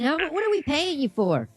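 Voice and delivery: ordinary delivery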